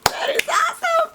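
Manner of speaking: a very high, thrilled ooh; gushing over a cool moment; delighted squeal. Real, not acted. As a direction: squeal at the screen like a fan. Reacting to the screen.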